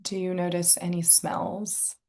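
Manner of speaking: calm, unhurried meditation guide, long pauses; guided meditation. Real, not acted. Inviting listeners to tune into senses and breath, guided meditation. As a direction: softly guide listeners into relaxation.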